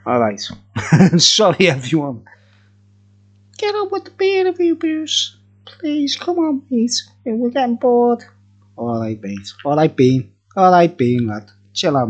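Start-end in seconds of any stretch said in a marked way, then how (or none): 3.6-8.2 much higher mock-viewer voice nagging Bruce; impatient heckling viewer. Bored viewer heckling Bruce to get on with the review, high mock voice. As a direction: squeak it out like a bored heckler in the comments.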